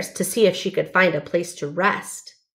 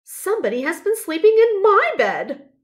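much higher mama bear voice; 12 semitones higher, 3 dB louder than usual. A narrator's reading. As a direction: pitch up for the mother bear. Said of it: "said Mama Bear".

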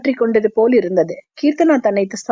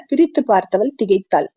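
usual speaking voice

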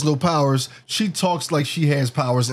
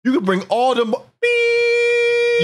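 bleeped out; 4 dB louder, much slower than usual. Retold or imitated. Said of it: "his response is".

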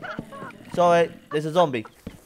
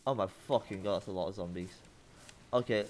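ordinary delivery

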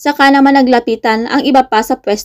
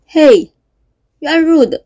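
mildly raised, indignant; a touch of angry protest, rising high on rude; understated angry retort; 3 semitones higher than usual. A narrator's reading. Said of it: "Galit ng tayo ni Casey".

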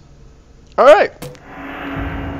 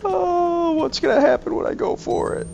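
high, whimpering dread; whiny fear. Real, not acted. Dreading grabbing the key.

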